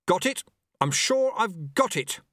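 high-pitched, urgent exclamation; excited. Acted. Apprentice getting control of the board, excited. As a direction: triumph under pressure.

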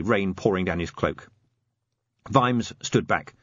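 plain reading voice

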